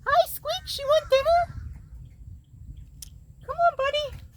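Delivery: very high, loud sing-song greeting; calling the cat. Real, not acted. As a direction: call the cat over to eat.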